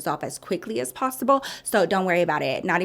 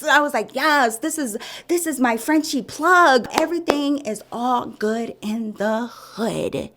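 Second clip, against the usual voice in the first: excited; 6 semitones higher than usual. Retold or imitated. Thrilled about puppy source.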